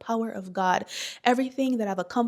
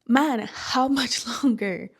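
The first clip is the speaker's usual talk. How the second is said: weary laugh; 3 semitones higher, 3 dB louder, slower than usual. Real, not acted. Exhausted, laughing despair.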